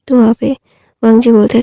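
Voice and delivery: plain reading voice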